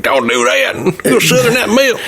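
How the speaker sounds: lightly done Southern US farmer; a hint of a Southern drawl, climbing high; Southern accent. Imitating the farmer alien for his cohost, light Southern accent. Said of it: "a Southern accent".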